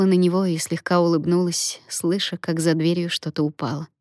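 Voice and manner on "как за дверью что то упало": ordinary delivery, nothing marked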